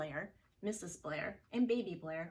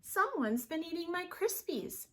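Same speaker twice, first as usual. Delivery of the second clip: higher mother bear voice; an indignant mama bear, higher; higher, louder mama bear; Missus Blair; 10 semitones higher, 4 dB louder than usual. Acted. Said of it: "said Missus Blair".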